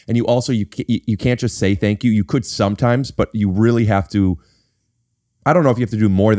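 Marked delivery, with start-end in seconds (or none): none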